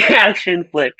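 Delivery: normal talking voice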